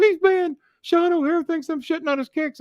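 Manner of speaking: very high mock-panicked whine; scared to death. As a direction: imitate a big man terrified, voice way up. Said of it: "scared to fucking death".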